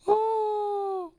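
tiny high squeaked oh; mouse squeak. Pretend. The church mouse noticed, high squeak.

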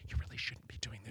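hushed inner-voice whisper, breathy and soft; conscience whisper. Retold or imitated. A child's conscience warning her off the Drano, whispered. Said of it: "that little voice that always says".

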